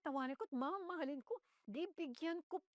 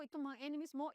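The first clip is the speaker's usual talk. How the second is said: ordinary delivery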